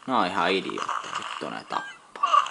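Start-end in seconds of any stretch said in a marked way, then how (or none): 1.2-2.4 high, slow, loud panicked cry that they kill; panicked yelp. Real, not acted. Gamer yelling at polar bears in panic. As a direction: shriek it out as the bears close in.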